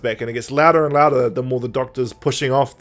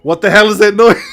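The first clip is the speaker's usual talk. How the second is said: loud, higher, laughing outcry; exasperated audience member; 5 semitones higher, 8 dB louder than usual. Retold or imitated. As an audience member hearing the noise, loud laughing complaint. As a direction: cry out in mock exasperation, breaking into laughter. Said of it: "as an audience member are like".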